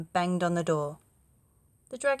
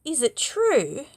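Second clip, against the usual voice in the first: sly flattery; 7 semitones higher, 6 dB louder, slower than usual. Acted. Princess flatters the dragon.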